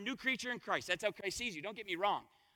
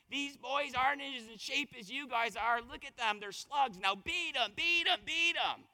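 higher-pitched imitation of an obnoxious sideline coach, building to a drawn-out chant; mock yell climbing high on the last words; mock screaming coach; 3 semitones higher than usual. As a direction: imitate a nasty coach yelling, without full volume. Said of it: "screaming at them about our people".

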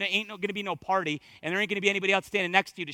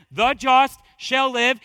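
slow, loud "shall live", each word weighted; stress on SHALL LIVE; 5 semitones higher, 10 dB louder, much slower than usual. Shown as a demonstration. Preacher showing the emphasis in Galatians, weighting "shall live". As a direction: spell out "shall live" with force. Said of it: "the emphasis is "shall live".

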